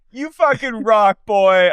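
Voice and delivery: mock mom. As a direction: gush it like a crazy mom. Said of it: "if my mom ever said".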